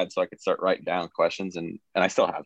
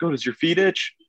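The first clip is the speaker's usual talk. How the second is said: high, rising question as dad; dad's puzzled question, lifted high at the end; retold dad; 11 semitones higher than usual. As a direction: imitate dad asking, pitched well up. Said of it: "My dad's like".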